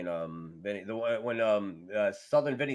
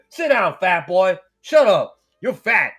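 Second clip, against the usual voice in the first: louder, slow taunting bark; mock heel taunt; 5 semitones higher, 10 dB louder, slower than usual. Ernest the Cat taunting a fan, mock loud bark. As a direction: sneer it down at the crowd. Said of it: "he'd look at me".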